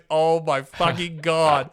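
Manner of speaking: mock horror, stretched out; imitated dismay. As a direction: act out a father's drawn-out disbelief. Dad discovers son atop pub bench.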